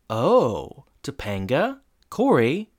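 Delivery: slow teasing singsong; drawn out, knowing tease; knowing Eric. Retold or imitated. Eric teasing the couple about prom night, slow and sly. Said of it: "And Eric goes".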